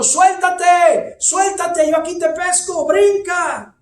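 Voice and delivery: high, loud desperate shout up to the window; father shouting. Retold or imitated. Father shouts up to his son in the burning house. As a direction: yell to the boy to let go and jump. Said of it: "el padre le gritaba desde abajo".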